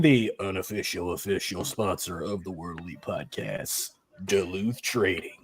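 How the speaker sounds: low, gravelly ad-read announcer; Sam Elliott announcer voice. Pretend. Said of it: "like how you do that announcer voice".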